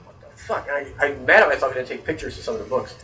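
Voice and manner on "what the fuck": voiceless, stunned whisper; awed whisper. Retold or imitated. Seeing the huge Bigfoot library, an awed whisper. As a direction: breathe it in disbelief. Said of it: "I'm like".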